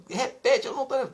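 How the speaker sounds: high, loud indignant denial; mock-furious high-pitched retort; imitated angry outburst. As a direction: act out an enraged wife demanding a repeat. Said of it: "ela bateu na mesa".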